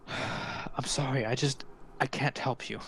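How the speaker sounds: soft, sighing regret; subdued, weary refusal; regretful apology. Acted. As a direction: apologise quietly, slowing on the sorry. Father sighs, refuses the party.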